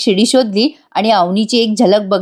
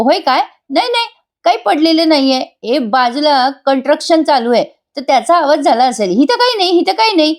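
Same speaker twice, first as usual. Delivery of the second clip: flustered denial; 6 semitones higher than usual. Retold or imitated. Raja covering up his fall.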